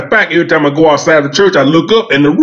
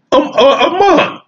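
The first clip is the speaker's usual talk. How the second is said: incredulous climb; 11 semitones higher, slower than usual. Real, not acted. Outraged at the sum.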